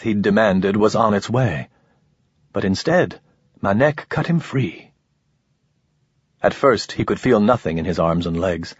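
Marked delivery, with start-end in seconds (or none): none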